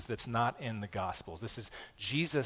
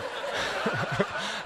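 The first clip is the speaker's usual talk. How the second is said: laugh; 6 semitones higher than usual. Real, not acted. Laughs at his own joke.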